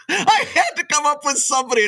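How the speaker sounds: high-pitched protest through laughter; laughing self-defense. Real, not acted. Joker defending his mother-in-law pick, laughing. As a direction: plead your case while cracking up.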